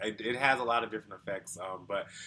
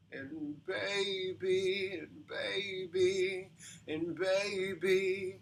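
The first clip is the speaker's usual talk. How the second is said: put-on slow, fried "and baby" with a little grit, higher; vocal fry; 8 semitones higher, 5 dB quieter, much slower than usual. Shown as a demonstration. Coach demonstrating fry with a little grit on "and baby". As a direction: sing "and baby" slowly through creaky fry. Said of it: "you can add a little bit of distortion and grit".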